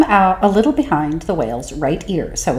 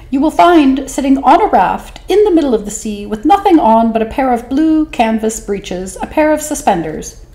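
a tiny sly squeak; 'stute fish voice; 8 semitones higher, 3 dB louder than usual. Acted. Small fish tempting the whale, high and sly. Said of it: "said the 'stute fish".

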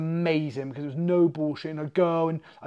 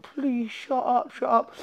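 embarrassed plea; 6 semitones higher, 3 dB quieter, slower than usual. Retold or imitated. Embarrassed pleading.

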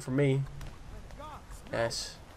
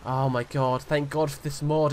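ordinary delivery

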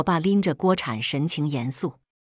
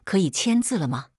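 不耐烦. A narrator's reading. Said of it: "不耐烦地起身道".

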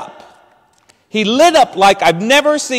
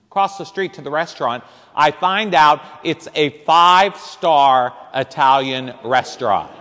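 usual speaking voice

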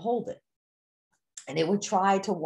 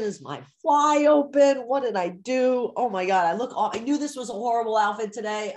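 mock panic, one worry tumbling into the next; panicked self-doubt; 3 semitones higher, 4 dB louder than usual. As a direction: act out a mounting flurry of embarrassed worry. Acts out panicked self-conscious inner voice.

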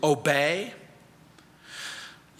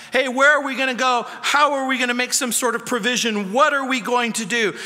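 higher, fast, anxious plea; worried disciples; 4 semitones higher, faster than usual. Acted. Disciples fretting to Jesus, higher and hurried. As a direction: voice the disciples' panic about feeding everyone.

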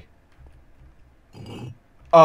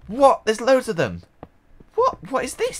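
squeaking much higher in astonishment; can't believe the loot; amazed disbelief; 12 semitones higher than usual. Real, not acted. Sudden heap of diamonds appears.

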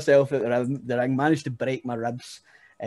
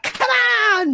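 much higher, louder mock hype shout; hyped entrance yell; 9 dB louder than usual. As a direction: scream it like a showman working the crowd. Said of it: "and I'm like".

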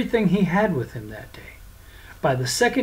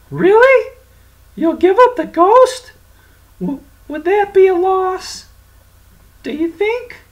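high, loud teasing voice; mock the devil's plea; mocking taunt; 9 dB louder than usual. Francis mocks the devil.

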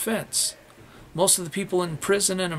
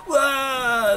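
mock wow; 9 semitones higher, 5 dB louder than usual. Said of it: "everybody goes".

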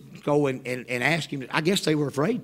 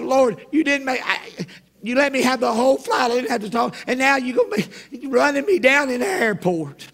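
high, loud comic wail to heaven; exasperated complaint; 9 semitones higher, 5 dB louder than usual. Acted. Traveler complains to the Lord about being chased, exasperated. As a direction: plead in raised, dismayed protest.

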